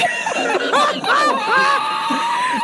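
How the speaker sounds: high burst of laughing; real laughter. Real, not acted. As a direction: crack up at the memory. Laughs at story.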